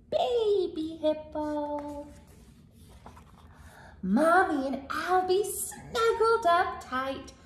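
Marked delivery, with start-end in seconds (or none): none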